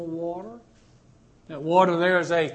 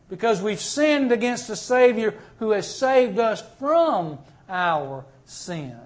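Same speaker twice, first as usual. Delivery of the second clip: ordinary talk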